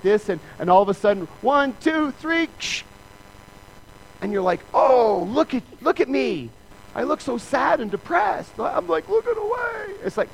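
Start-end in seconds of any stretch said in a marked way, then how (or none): 4.7-9.9 whining at photo. Pretend. Whining at a bad family photo.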